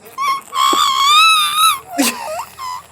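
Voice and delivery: high delighted shriek of laughter; squealing laugh. Real, not acted. Dog reacts outside.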